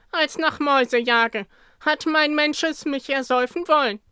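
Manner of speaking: very high whiny cat voice; squeaky high cat lamenting being drowned; sulky old cat. Acted.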